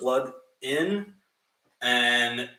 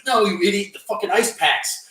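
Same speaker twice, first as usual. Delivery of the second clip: raised, scolding other voice; exasperated friend; 7 semitones higher, 4 dB louder than usual. Retold or imitated. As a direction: snap at him like an annoyed friend.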